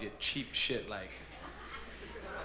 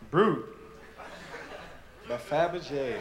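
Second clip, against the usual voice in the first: reading the gift; 2 semitones higher than usual. Retold or imitated. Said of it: "open it up and say".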